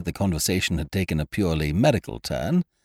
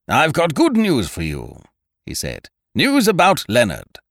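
higher, emphatic hearty man; Ukridge, hearty and bringing news; 8 semitones higher, 4 dB louder than usual. A narrator's reading. Ukridge telling his hostess of good news, hearty and emphatic. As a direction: announce it with buoyant confidence.

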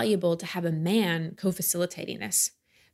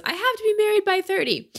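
high, loud mock-panicked quote; frantic younger self; 13 semitones higher, 4 dB louder than usual. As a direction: squeal it like a desperate deadline. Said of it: "I was one of those".

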